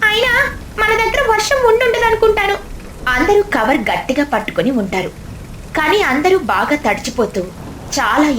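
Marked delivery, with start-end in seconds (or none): 0.1-2.6 put-on child voice. Character hopes for no rain.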